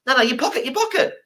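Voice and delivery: very high squeaky begging; wheedling plea. Retold or imitated. Egyptian guy begging for a cigarette, very high squeaky. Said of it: "the guy's like".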